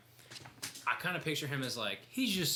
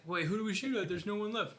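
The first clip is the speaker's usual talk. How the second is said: mock confused; 9 semitones higher than usual. Acting confused character quote.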